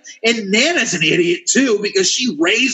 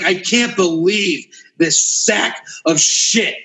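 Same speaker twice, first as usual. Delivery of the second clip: ordinary delivery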